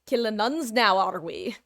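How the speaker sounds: bad Irish accent, butchered on purpose; a clumsy attempt at an Irish accent. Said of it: "I can't do an Irish accent, so forgive me as I butcher this".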